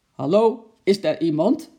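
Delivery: loud, stern call; strenge stem. A narrator's reading. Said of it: "riep hij met een strenge stem". (luid en streng roepen)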